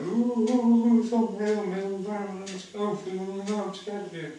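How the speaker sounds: high, loud, whimpering fake fear; mock a timid new inmate squealing in fright; mock scared. Prisoner mocking a frightened newcomer, hammed-up squeal. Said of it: "otherwise you're like".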